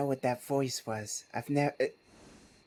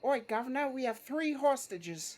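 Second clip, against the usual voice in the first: a cheeky London crook announcing hostages; cockney accent; 9 semitones higher than usual. Acted. To the police during the bank job, cockney accent. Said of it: "That's amazing".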